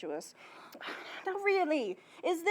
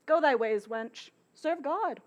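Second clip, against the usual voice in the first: usual speaking voice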